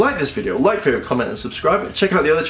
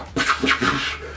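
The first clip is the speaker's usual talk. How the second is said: voiceless whooshing bursts; mouth-made machine sound effects; imitated mechanical noises; 2 semitones lower, 4 dB quieter than usual. Reviewer mimicking a Zord attack, whooshing noises.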